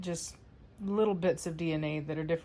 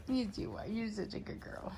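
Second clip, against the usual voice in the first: fond dog praise; 3 semitones higher, 6 dB quieter than usual. Real, not acted.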